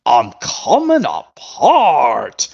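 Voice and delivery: very drawn-out cartoon exclamation; exclaiming. Fan imitating Scruffy falling to pieces, drawn-out exclamation. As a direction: stretch each word in mock distress. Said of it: "Scruffy's about to exclaim out".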